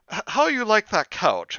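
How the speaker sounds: put-on high accented broken-English question; a host fishing for praise; lightly accented mother asking; imitating his mother. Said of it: "his mom says to us".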